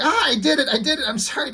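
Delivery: high, panicky blurted apology; a kid cracking under pressure and spilling it; panicked confession. Acted. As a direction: Calvin caving to his dad, pitched high with panic. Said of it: "He just freaks out".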